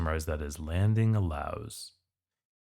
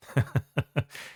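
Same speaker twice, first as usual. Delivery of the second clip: short laugh; 7 semitones higher than usual. Real, not acted. Laughs at the story.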